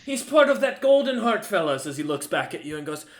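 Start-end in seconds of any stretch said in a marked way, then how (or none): 0.0-1.9 high, loud taunting guard voice; mocking guard. Guard pretends to recognize the merchant, loud mockery. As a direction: jeer at the visitor, pitched up and loud. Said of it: "he's making fun of you".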